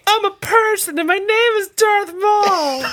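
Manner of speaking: high, slow goofy voice; silly high voice. Pretend. Silly voice for a character.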